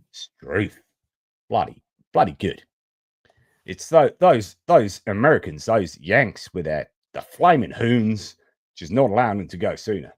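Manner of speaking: hammed-up Aussie accent; mock Australian accent. Host playing an Aussie cursing the Yanks, mock Australian accent. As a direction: grumble like a caricature Australian. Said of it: "it's cultural appropriation time".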